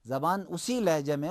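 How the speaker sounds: ordinary talk, nothing marked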